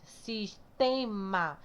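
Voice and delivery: SISTEMA sounded out slowly, syllable by syllable; slow teacherly split of SISTEMA; separated syllables. Shown as a demonstration. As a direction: draw out each syllable of Sistema to find the strong one. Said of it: "como é mais forte".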